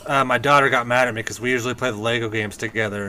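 usual speaking voice

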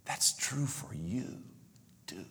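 soft, unvoiced whisper; God's whisper. Preacher voicing God's gentle whisper to him. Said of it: "the gentle nudge and whisper of God".

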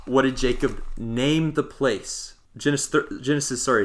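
ordinary talk

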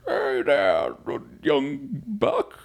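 put-on high, creaky old-timer greeting; old man impression. Podcaster does his future old-man voice for listeners, hammed-up. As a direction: play a doddering grandpa hailing a youngster. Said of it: "this is me as an old man".

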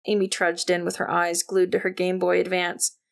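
ordinary delivery, nothing marked

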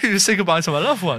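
laughing through a mock farewell. Joking farewell to his friend, laughing while speaking.

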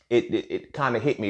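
ordinary delivery

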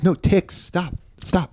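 high, small mock plea; half-hearted acted protest; Jesus objecting. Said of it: "he's like".

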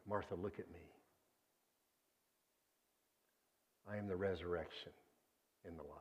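softly, looking her in the eye; hushed and intimate, close to her face; tender, personal. Pretend. Jesus speaking gently to grieving Martha, tender and hushed. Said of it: "He's addressing her very personally".

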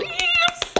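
imitating a giddy, very high squeal; giddy little squeal. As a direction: squeal it like an excited friend, loud and tiny. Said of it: "she does her giddy little".

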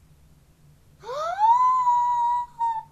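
huge, high, loud gasp; shocked gasp. Real, not acted. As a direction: gasp long and piercingly in astonishment. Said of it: "you're shocked".

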